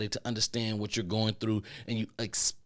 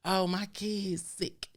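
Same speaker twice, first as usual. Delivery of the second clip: high, slow whiny pleading; mock a coworker faking hardship; mock sob-story whine; whiny fake excuse; 8 semitones higher than usual. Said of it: "You don't have to play games".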